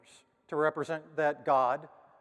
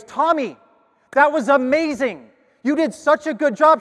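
much higher and louder, gushing praise; thrilled teacher; 11 semitones higher, 13 dB louder than usual. Retold or imitated. Teacher praising Tommy in front of parents, loud gushing delight. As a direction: burst out with delight at the student.

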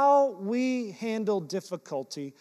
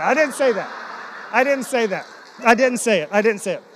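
insistent denial; 3 semitones higher, 8 dB louder than usual. Real, not acted. Joking denial of a bribe line.

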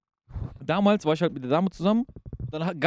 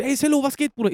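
high-pitched, lively schoolyard hail; mock hearty hello, pitched up; imitated greeting; 8 semitones higher than usual. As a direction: call out a greeting like an acted-out schoolmate. Acted greeting from schoolyard group.

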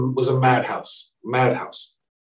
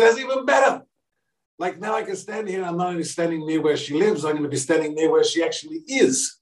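excited gushing; 8 semitones higher than usual. Retold or imitated. Overjoyed self at Teresa's door.

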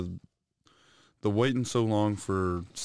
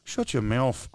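playful put-on English accent; hammed-up English vowels; mock English accent. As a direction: tell them off as a joke Englishman. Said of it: "English accent".

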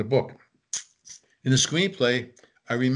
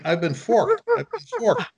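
retold yell; 10 semitones higher than usual. Brother hit by a thrown fork, retold as a high mock yell. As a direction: imitate a brother yelling in comic outrage. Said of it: "And he's yelling".

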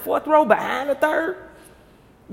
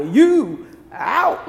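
umpire call; 4 semitones higher, 7 dB louder than usual. Retold or imitated. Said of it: "you a doggone umpire".

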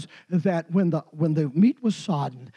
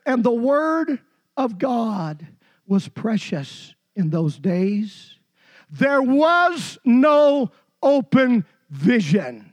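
scripture reading; 3 semitones higher, 3 dB louder, slower than usual. Acted. Reads scripture aloud slowly.

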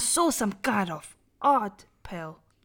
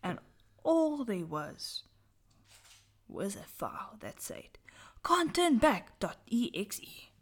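hushed spooky storytelling; dramatic whisper; 2 semitones higher, 6 dB quieter than usual. Acted. Reading a horror story aloud to friends, whispered. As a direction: whisper it to give chills.

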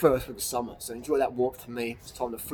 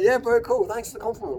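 high, breezy sarcastic thanks; dismissive mock thanks; 9 semitones higher than usual. Answering a hater with a high, breezy brush-off. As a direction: brush the compliment off lightly. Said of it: "I'm like".

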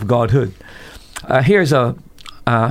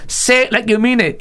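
high, pushed preacher's yell; imitated yell; 10 semitones higher than usual. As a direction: rouse the crowd like a shouting preacher. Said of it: "He's yelling".

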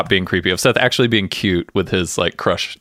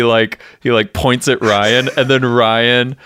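ordinary delivery

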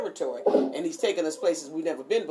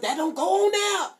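mock indignant dad, pitched high; mad dad; 10 semitones higher than usual. Dad forbids ketchup on certain items, mock mad. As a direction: imitate a dad objecting, voice jumping up. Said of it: "He would get mad if you put ketchup".

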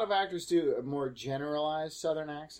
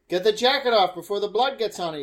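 loud urging; 4 semitones higher, 7 dB louder than usual. Real, not acted. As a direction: call out loudly, high. Urging characters on screen.

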